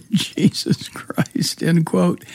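breathless laugh under the words; laughing whisper. Real, not acted. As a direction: whisper it while laughing in disbelief. Host laughs at quoted remark.

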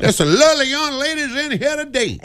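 put-on leering old Southern gentleman; Strom Thurmond. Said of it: "you talk like Strom Thurmond".

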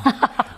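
brief laugh at your own dry quip; amused laugh. Real, not acted.

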